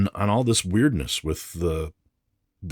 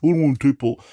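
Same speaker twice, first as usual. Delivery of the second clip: much higher, louder whiny mock collector voice; 8 semitones higher, 10 dB louder than usual. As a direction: sneer at the collectors in a silly whine. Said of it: "dumb shit collectors, like, think".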